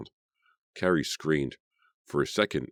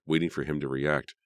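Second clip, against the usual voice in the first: ordinary delivery, nothing marked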